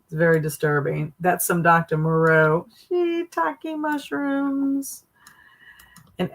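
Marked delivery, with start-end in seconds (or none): 2.9-5.0 slow, high mock curse; high drawn-out exclamation. Real, not acted. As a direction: stretch it out up high. Laughing exclamation at picture.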